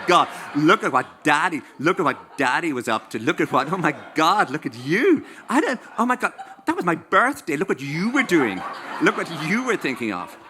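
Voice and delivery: put-on the kids thrilled to snoop through Daddy's diaries; gleeful gossip. Children gleefully reading Daddy's diaries.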